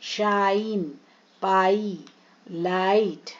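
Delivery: शाइन, पाई, लाइट with the "ai" held long; long I sounds. Shown as a demonstration. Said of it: "आई sounds".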